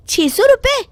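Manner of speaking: high, incredulous squeak at the price; shocked at price. Acted. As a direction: cannot believe the number. (कीमत सुनकर हैरान ऊँची आवाज़)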